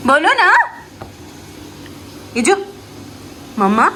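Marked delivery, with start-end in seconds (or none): 0.1-0.6 much higher wheedling plea. Real, not acted. Mother pleading with her baby to say it. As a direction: beg her sweetly up high.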